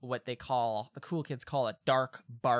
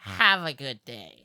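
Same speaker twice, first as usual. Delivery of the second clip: drawn-out, louder send-off through a squinched face; squinched-up coworker; 5 dB louder than usual. Acted. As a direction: say it slowly with face scrunched up. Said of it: "squitches up his face".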